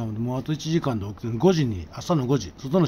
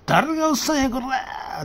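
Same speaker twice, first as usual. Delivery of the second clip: much higher, louder snapping retort; mock angry auntie yell; indignant yell; 5 dB louder, slower than usual. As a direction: storm out offended at being called a man. Angry woman storms out.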